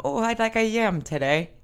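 put-on a clumsy, wobbly Irish accent. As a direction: slip into an Irish accent by accident. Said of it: "Why'd you go Irish?".

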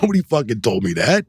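high, retold protest; acting out incredulous complaint; indignant disbelief. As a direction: replay your aggrieved surprise. Retelling his protest at missing news.

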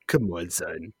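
low, soft deadpan aside; deadpan disbelief. Acted. Podcaster reacting to a wrestler's new gimmick, low deadpan. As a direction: mutter it very low and quietly.

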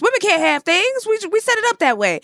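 high, fast mocking men's voice; mocking sexist men. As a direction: sneer it out as scornful men. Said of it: "They were like".

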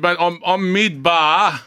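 high, loud, stretched over the bar noise; calling out. Retold or imitated. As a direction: yell it down the phone from the bar. Retold phone call, loud bar.

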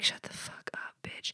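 hushed, breathy insult under the breath; imitating women cursing her in a whisper; mock whispered curse. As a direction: whisper it like a catty aside. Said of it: "some women are cursing me right now".

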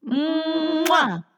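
loud smacking kiss sound; blowing an air kiss. Real, not acted. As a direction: blow a big kiss goodbye.

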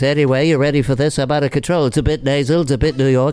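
lightly voiced nasal New York host; a bit nasal, a bit New York; Regis impression. Regis greeting his TV audience, lightly played. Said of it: "it's Regis".

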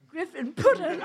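words squeezed out between laughs; can't keep a straight face reading; laughing through it. Real, not acted. Reader cracking up mid-sentence.

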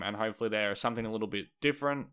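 usual speaking voice

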